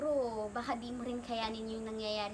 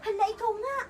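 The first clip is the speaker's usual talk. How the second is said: high, loud startled disbelief; amazed, excited and much higher-pitched; astonished; 10 semitones higher, 4 dB louder than usual. Acted. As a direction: gasp it out like you've seen the impossible. Startled at meeting Rizal's apparition.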